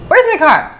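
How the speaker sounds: squeaked-up shocked question; retold alarm. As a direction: replay the panicked disbelief. Discovers the car has vanished.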